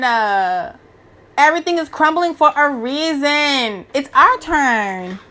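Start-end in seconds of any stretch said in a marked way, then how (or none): none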